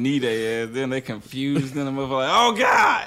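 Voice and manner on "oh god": high shouted panicked yelp; mock startled yelp. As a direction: yelp like a surprised fighter. Imitating a confused kickboxing opponent.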